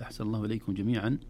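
ordinary talk

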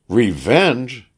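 high, vengeful exclamation; crying out. A narrator's reading. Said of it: "cried the woman".

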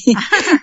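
short high-pitched laugh; amused laugh. Real, not acted. Woman mistaken for the widow at the wake, amused laugh. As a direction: laugh at your own story.

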